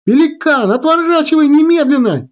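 very high, urgent shouted order; full-voiced yell. A narrator's reading. Girl ordering pilot to turn away, urgent yell. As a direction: cry out the order at the top of the range. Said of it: "Кира кричит в голос".

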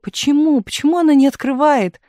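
worried friend demands why the door stays shut; alarmed. A narrator's reading. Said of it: "встревожилась Таисия".

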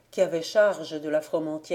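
ordinary delivery, nothing marked

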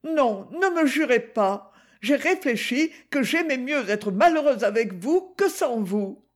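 lightly voiced young Gigi, protesting; Gigi, young girl. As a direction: play the girl, defiant and earnest. Gigi's decision.

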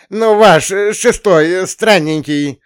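loud high hesitant grasping; fumbling counselor. Acted. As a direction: struggle to find the word loudly.

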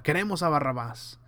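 usual speaking voice

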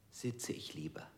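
usual speaking voice

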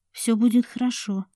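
ordinary delivery

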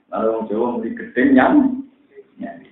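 usual speaking voice

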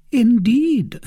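startled unease in a high voice; anxious. A narrator's reading. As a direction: react anxiously, pitched high. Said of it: "in an anxious tone".

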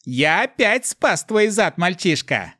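high-pitched, arrogant inner demon voice; Mefis, haughty. A narrator's reading. Voice in the hero's head boasting of saving him, haughty. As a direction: sneer it slowly, high and loud, looking down on him. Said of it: "заносчиво прошелестел Мефис".

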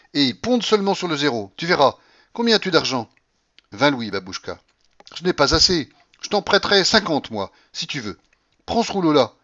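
high, loud urging; bossy old grandmother. Acted. Grandmother urges Alexis to try roulette, bossy. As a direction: press the money on him.

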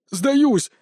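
high-pitched, panicked yelp; wailed surrender. A narrator's reading. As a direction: cry out as if giving up in pain. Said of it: "завопил Павел".

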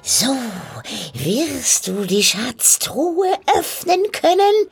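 high, slow, drawn-out witch voice; croaking witch, instructing. Acted. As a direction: lay out the task as a wily old hag, stretching key words. (krächzende Hexe, langsam und eindringlich)

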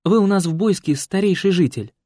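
put-on flattering young woman. A narrator's reading. Young woman flattering old man.

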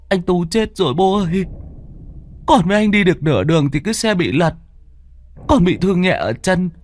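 high, quick, mildly tearful telling; a boy reporting a death, a touch of sobbing; lightly voiced wailing son. A narrator's reading. Fake son tells his father the brother died, mildly tearful and high. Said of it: "vừa gào khóc".

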